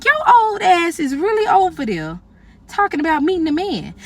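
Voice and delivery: drawing out the judgment; incredulous inner sneer. Retold or imitated. Silent judgment of old lady.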